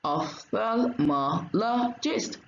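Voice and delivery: Ophthalmologist broken slowly into five separate beats; very slow, chopped-up Ophthalmologist, each syllable on its own. Shown as a demonstration. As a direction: count out Ophthalmologist, pausing after each syllable. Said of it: "how many is that?".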